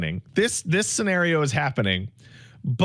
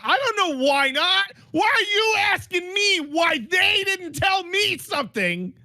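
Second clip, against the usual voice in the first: exasperated; 11 semitones higher than usual. Retold or imitated. Retelling frustrated bank exchange.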